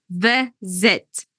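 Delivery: "visit" split into two beats, "vi", pause, "zit"; syllable by syllable. Shown as a demonstration. Teacher showing two syllables, visit broken in two. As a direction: separate the two syllables of "visit" with a clear gap. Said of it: "but it's two syllables".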